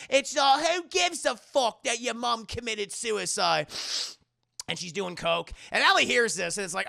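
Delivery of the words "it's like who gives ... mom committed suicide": high loud sneer; lightly voiced cruel girl; a hint of a sneering drunk; mean girl. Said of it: "Jocasta's like".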